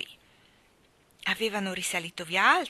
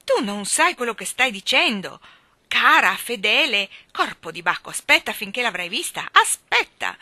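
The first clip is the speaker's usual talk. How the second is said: loud, exasperated rant; rant, building to a high cry; outraged, emphatic outburst; indignant shouting; 4 semitones higher, 9 dB louder than usual. A narrator's reading. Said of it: "gridò il signor Banks".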